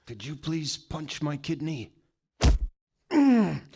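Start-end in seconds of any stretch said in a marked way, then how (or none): none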